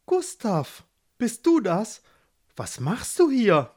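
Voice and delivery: put-on high-pitched, surprised young woman's call; Barbara calling out. A narrator's reading. Barbara calls to Gustav as she notices him, high and questioning. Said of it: "rief sie".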